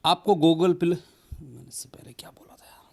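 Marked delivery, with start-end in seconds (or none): 1.4-2.8 quick whisper to himself, off-mic; whispered aside. Real, not acted. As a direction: mutter it under your breath as if you forgot your line. Forgets line, mutters to himself. (खुद से फुसफुसाकर)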